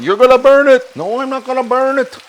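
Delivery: high, loud nagging back-and-forth; mock argument. Mock argument with imagined scolding viewers.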